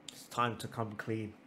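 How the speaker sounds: very soft, low admission; hushed confession. Acted. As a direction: drop low for a staged confession. Staged confession.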